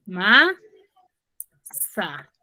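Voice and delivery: "Ma, sa" said as two separate beats with a pause; teacher breaks "Ma, sa" apart so the class hears each syllable; split into syllables. Shown as a demonstration. Teacher to pupil, splitting the word into syllables. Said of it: "cuál es su sílaba tónica".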